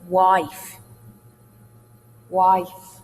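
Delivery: WIFE with a long I gliding as diphthong; spaced demo of diphthongal WIFE; rising diphthong. Shown as a demonstration. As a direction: glide through the I of WIFE. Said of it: "Canadian rising diphthong".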